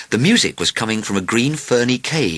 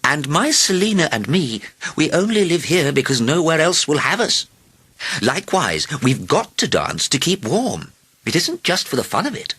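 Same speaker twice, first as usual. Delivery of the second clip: mildly stern grandmother, pitched higher; rather stern; 7 semitones higher than usual. A narrator's reading. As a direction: explain it firmly to the king. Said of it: "said rather sternly".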